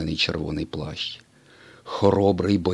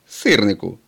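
louder reproach; reproachful; 2 semitones higher, 6 dB louder than usual. A narrator's reading. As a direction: scold him for the tall tale. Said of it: "з докором сказав блискавка".

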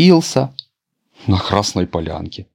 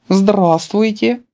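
polite greeting; 13 semitones higher, much slower than usual. A narrator's reading. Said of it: "вежливо сказала Красная Шапочка".

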